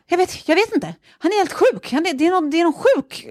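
high-pitched rattled blurting; shaken. Retold or imitated. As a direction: re-enact panicked explaining, voice shooting up. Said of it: "jag bara är helt skakad".

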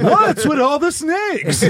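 slow, high mock observational question; sitcom comedian parody. Riffing on Seinfeld with friends, hammed-up comic voice. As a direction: drag it out like a parody stand-up.

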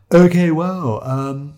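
high, hesitant put-on voice; awkward photographer. Photographer abandoned by subjects riding off, awkward. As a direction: start loud, then falter.

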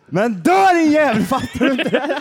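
furious relative. Acted. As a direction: hurl it at the dying man. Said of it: "Man är skitarg". (spelat rasande, högre och långsamt)

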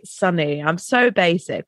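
usual speaking voice

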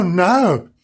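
ordinary delivery

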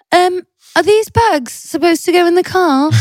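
high, slow mock of a dopey husband; mocking Pete. Wife mocks husband's clueless question, high and slow. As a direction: ham up the clueless partner. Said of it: "I love this accent".

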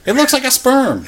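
high loud insistent repeat; emphatic exclaim. Real, not acted. Arguing with cohost about the ship, loud and insistent. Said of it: "repeats for emphasis".

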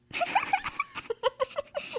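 squeaky high laugh; high-pitched laugh. Real, not acted. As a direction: burst out in a shrill laugh. Laugh after vodka joke.

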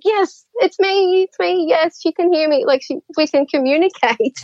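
high, eager imitation of an overjoyed spirit; retold excited relief. Retelling spirit's joy at being recognised.

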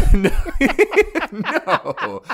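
laughing no. Real, not acted. Laughing denial of doing press.

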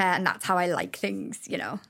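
ordinary delivery

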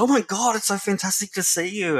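high-pitched mock delighted greeting; excited greeting. Youth worker and boy meet in the laneway, excited greeting. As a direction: imitate someone thrilled at a chance meeting.

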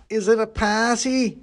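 high, drawn-out tipsy character voice; slow, high-pitched drunken pudding. A narrator's reading. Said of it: "has definitely been drinking".

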